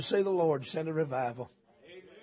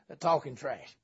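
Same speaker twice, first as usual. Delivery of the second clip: normal talking voice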